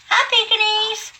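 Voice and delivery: squeaky high coo for the dog. Real, not acted. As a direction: go way up high, cooing at the pet. Greeting her dog again.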